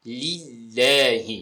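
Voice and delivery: للہ very slowly as "lil-laa-hee"; clear zer. Shown as a demonstration. Teacher repeating the right vowels for learners, clear zer. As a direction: stretch للہ, ending on a pure "hee". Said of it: "ہا زیر ہی".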